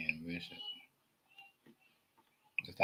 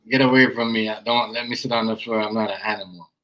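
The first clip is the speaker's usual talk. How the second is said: mocking the pastor; 9 dB louder than usual. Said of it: "he was trying to be".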